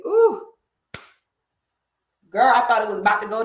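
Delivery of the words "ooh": high, loud little whoop; sudden squeal of released tension; rattled "ooh". Real, not acted. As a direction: shake off the nerves with a high cry. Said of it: "I had to calm down real quick".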